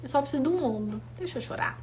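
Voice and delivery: hushed, almost breathless plea; whispered ask to just let her cry; soft, gentle request. Retold or imitated. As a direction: ask quietly for a shoulder to cry on. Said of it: "eu falo".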